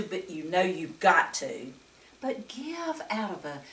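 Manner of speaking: usual speaking voice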